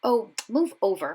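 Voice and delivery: loud impatient snarl; a loud snarled order. A narrator's reading. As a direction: shove him aside with your voice. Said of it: "Hermione snarled".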